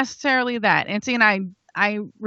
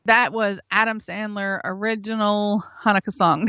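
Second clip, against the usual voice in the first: usual speaking voice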